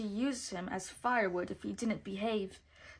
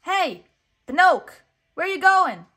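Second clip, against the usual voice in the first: high, loud yell; raised-voice call, much higher; yelling louder; 9 semitones higher, 16 dB louder than usual. A narrator's reading. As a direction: shout to be heard across the street. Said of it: "Jiminy yelled a little louder".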